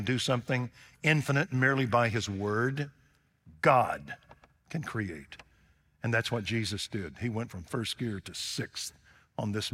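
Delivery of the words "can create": ordinary talk, nothing marked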